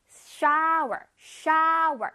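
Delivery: "shower" with a long open ow, r ending; wide-mouthed shower. Shown as a demonstration. Teacher models for children, wide open ow. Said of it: "입을 크게 벌려야 돼".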